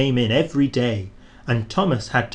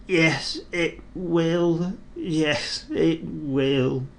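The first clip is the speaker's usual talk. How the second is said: sad groany creak; 4 semitones higher, much slower than usual. A narrator's reading. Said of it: "in a sad, groany, creaky sort of voice".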